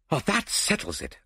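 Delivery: ordinary delivery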